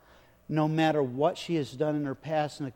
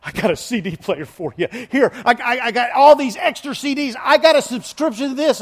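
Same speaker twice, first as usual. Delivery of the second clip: loud, higher, urgent salesman burst; frantic gift giver; 8 semitones higher, 11 dB louder than usual. Retold or imitated. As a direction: rush in shouting offers. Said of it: "someone comes running in going".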